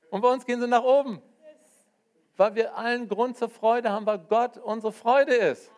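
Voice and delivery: ringing pulpit joy; joyful preaching. Real, not acted. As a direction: proclaim it to the whole room. Preacher rising to joyful climax.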